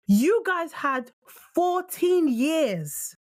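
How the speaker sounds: high, very slow, word by word; drawn-out, emphatic fourteen years; slow emphatic stress. Real, not acted. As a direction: spell it out slowly to make the point. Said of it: "break it down for you".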